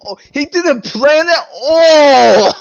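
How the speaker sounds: loud, slow laughing realization; amused disbelief. Real, not acted. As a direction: marvel at the plot hole.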